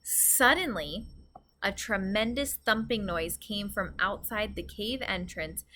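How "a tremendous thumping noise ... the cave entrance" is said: plain reading voice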